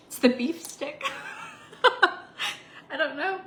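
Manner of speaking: laughing through the words. Real, not acted. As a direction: giggle it out. Laughing at her own hiccups.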